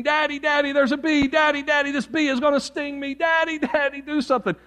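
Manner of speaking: high, urgent mock scream for Daddy; screaming little girl. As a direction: imitate a frightened little girl crying out. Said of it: "this little girl is screaming".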